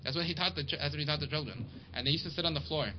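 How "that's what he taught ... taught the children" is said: ordinary delivery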